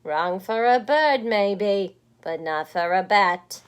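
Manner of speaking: loud, firm creature voice; loud firm bat. Acted. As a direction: correct her loudly. Bat corrects her.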